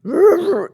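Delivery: loud imitated bark, rolled out; deep dog bark. Shown as a demonstration. Owner repeating the dog's bark on request, deep bark. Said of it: "It's really deep".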